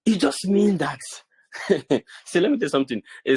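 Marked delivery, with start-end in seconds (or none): 1.5-2.0 short chuckle. Real, not acted. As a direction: let out a quick chuckle. Brief laugh while explaining.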